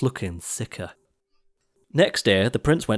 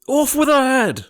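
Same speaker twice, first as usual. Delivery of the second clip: very high, loud royal roar; 6 dB louder than usual. A narrator's reading. Prince roaring his order at the sister. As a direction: bellow the order. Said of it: "the prince roared back".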